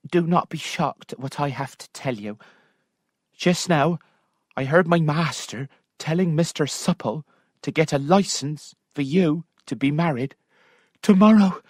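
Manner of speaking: lightly voiced maid; a servant breaking news; anxious maid. Maid warning her mistress, lightly voiced.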